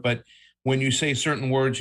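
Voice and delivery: ordinary delivery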